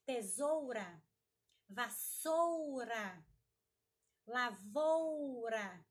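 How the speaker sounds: "Tesoura, vassoura, lavoura" slowly, with long, strong "ou"; loud, careful "Tesoura, vassoura, lavoura", stressed syllable stretched; full diphthong. Shown as a demonstration. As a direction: drag out the "ou", sounding the u, in each word. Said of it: "nós não podemos engolir".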